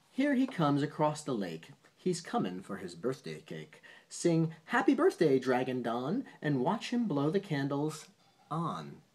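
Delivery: bouncy rhyming read-aloud; reading a poem to a child. Acted. Parent reading a bedtime poem to a child, sing-song read-aloud. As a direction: lilt the rhyme for a child at bedtime.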